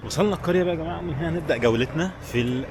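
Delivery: ordinary delivery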